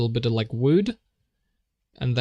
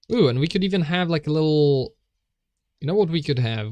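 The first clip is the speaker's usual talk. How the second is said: excited planning; 4 semitones higher, 4 dB louder, faster than usual. Real, not acted. Sudden new idea while planning.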